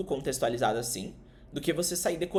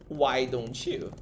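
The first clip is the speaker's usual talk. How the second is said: slow model phrase; much slower than usual. Shown as a demonstration. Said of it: "você vai dizer".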